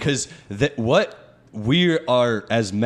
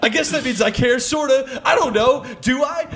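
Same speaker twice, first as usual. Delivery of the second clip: much higher, whiny unsure voice; mock flustered woman; 11 semitones higher than usual. Mimicking a girl who realises she cares, high and flustered. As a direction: play her caught out and flustered. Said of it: "And then you're like".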